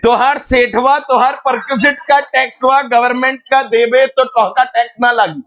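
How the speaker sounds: high-pitched, rapid Bhojpuri patter; Bhojpuri accent. Shown as a demonstration. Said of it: "Bhojpuri.".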